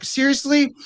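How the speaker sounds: very high, disbelieving seriously; mock disbelief at your own past; incredulous. Coach voices the client's disbelief at the old trauma, very high. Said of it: "You're like".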